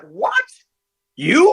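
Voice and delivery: high-pitched incredulous questions; mock shock, jumping high; freaked-out disbelief. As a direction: imitate stunned parents, voice leaping up. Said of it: "they were freaked out".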